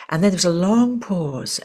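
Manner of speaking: ordinary talk, nothing marked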